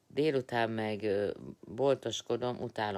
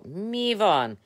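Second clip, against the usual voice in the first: high, loud, slow what?; drawn-out outraged what; indignant surprise; 11 semitones higher, 9 dB louder than usual. Real, not acted. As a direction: react in disbelief. Indignant at the question.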